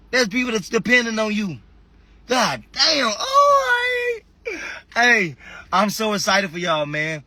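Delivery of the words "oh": emotional cry. Real, not acted. Overcome with emotion on live.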